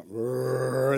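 rolling, drawn-out mock growl; playful scary "rrrr"; monster growl. Dad playing monster to scare kids.

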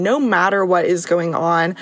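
usual speaking voice

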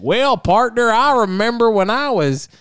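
jokey rancher voice; cowboy voice. Retold or imitated. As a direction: swing into a hammed-up old Western accent. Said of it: "how do I do a cowboy voice".

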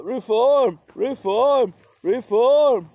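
high, loud squeaky mock tsar chanting reform; mock Peter the Great. Comic narrator mocking Peter the Great's reforms, high and loud. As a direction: ham it up as a giddy reformer tsar. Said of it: "Peter the Great was like".

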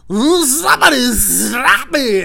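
high, loud, extremely drawn-out catchphrase; wild elongated shout; Jim Carrey. Retold or imitated. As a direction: ham it up as a manic comedy star, stretching every sound. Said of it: "Imagine being Jim Carrey".

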